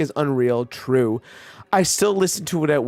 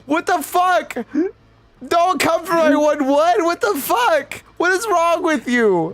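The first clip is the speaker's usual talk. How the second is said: high-pitched outraged yelling, much higher; mock outrage; 13 semitones higher, slower than usual. Real, not acted. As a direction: yelp in disbelief at your friend. Outraged at friend's quick run.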